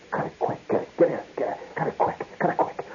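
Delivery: hissed, repeated undertone order; urgent whisper. Retold or imitated. Lion tamer secretly telling reporter to stop, urgent whisper. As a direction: whisper it fast through a panic.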